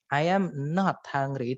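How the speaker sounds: "am" and "not" spelled out slowly and firmly; emphatic refusal. Shown as a demonstration. Teacher demonstrating a firm refusal, slow emphatic stress. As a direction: insist you will not eat. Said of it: "menekankan kalau dia sudah nggak mau".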